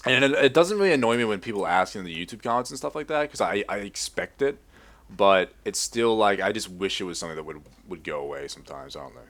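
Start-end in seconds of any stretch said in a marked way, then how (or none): none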